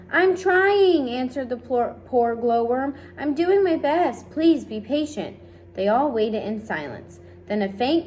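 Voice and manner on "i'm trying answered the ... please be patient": high, loud and strained; anxious little insect doing its best; flustered glowworm. Acted. Glowworm answers the impatient crowd, high strained pleading.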